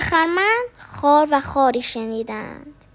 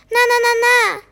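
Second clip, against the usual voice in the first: quick denial; 7 semitones higher, 4 dB louder, faster than usual. A narrator's reading. Denying quickly.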